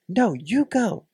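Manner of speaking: high-pitched mock insistence; insistent retort. Imitating a woman sending her man off, mock insistent.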